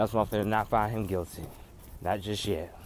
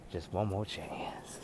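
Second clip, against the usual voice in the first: breathy whispered tag; 3 semitones lower, 12 dB quieter, slower than usual. Acted. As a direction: whisper the line softly. Whispered ending of song.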